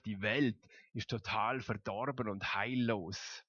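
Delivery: ordinary delivery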